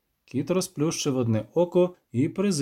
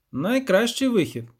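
a touch higher, livelier poet character; lightly pitched-up storybook bard; lightly voiced poet; 7 semitones higher, 4 dB louder than usual. Poet urging his witcher companion, lightly voiced.

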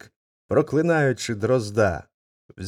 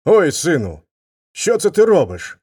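louder, pained cry; father crying out; 4 semitones higher, 6 dB louder than usual. Acted. Father struck by his son, crying out louder. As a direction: yelp at the son who hit him. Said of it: "крикнув батько".

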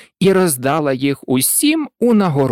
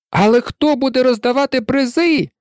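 higher-pitched questioning voices; chorus asking; 8 semitones higher than usual. A narrator's reading. Crowd asking who gives prizes, a high chorus. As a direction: ask it high, as a whole crowd. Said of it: "запитав цілий хор голосів".

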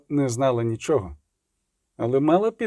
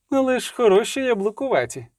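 high, excited exclamation; high-pitched admiring call; 9 semitones higher than usual. A narrator's reading. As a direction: cry out in delight, pitched high. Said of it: "крикнула Іскра".

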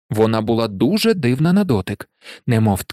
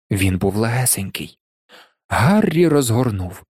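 usual speaking voice

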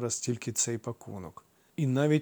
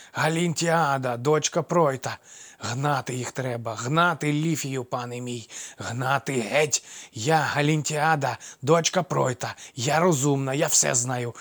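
put-on half-crazed old crone; 2 semitones higher, 4 dB louder than usual. A narrator's reading. Old dwarf woman tells Amphitryon to drive them off. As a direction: crow it like a mad old woman.

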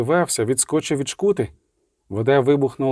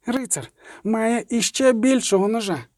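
put-on much higher, piping little-boy voice; crowing child; 8 semitones higher than usual. A narrator's reading. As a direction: crow it out like a small boy. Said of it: "раптом прокукурікав Еверет". (дитячий кукурікаючий голосок)

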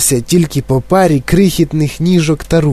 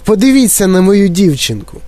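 higher, horrified exclamation; shrieking; 6 semitones higher than usual. A narrator's reading. Mother points everyone to her daughter, shrieking. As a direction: demand that everyone look. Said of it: "заверещала пані Борегард".

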